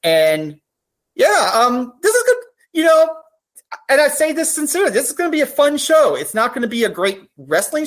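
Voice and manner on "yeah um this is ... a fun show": holding back tears while praising the show; choked up. Real, not acted. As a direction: voice cracking high with real tears. Emotional praise of Pride show.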